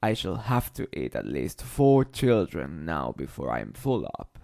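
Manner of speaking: deep crocodile. A narrator's reading. Crocodile vowing to eat four children. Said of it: "he said".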